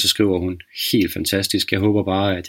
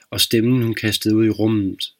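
usual speaking voice

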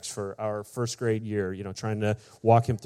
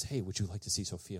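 hushed offer; 2 semitones lower, 10 dB quieter than usual. Retold or imitated. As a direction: softly imitate a secretive offer. Son quietly offers to point her out.

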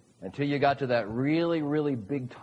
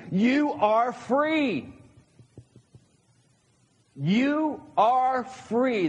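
You are free, slow and higher, spoken as a solemn decree; commanding God; 8 semitones higher, much slower than usual. Acted. Preacher voicing God's command to humanity, slow and solemn. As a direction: proclaim it slowly, like a command from on high. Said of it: "In other words, God's saying".

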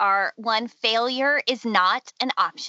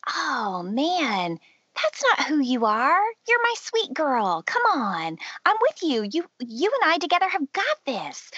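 higher, sweetly encouraging voice; encouraging conviction; 4 semitones higher than usual. Acted. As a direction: coax and reassure a dear girl. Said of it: "conviction will sound something like".

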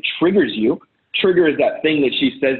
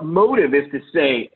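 ordinary talk

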